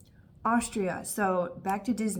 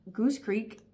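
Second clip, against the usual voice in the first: normal talking voice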